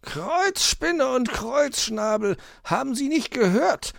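high, slow exasperated snap; hissed out. Acted. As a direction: snap at her as the fox. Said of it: "fauchte der Fuchs".

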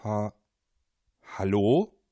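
a faltering, unsure hallo; stammered, nervous hello; hesitant. Acted. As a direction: test the line timidly. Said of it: "fragte zögerlich".

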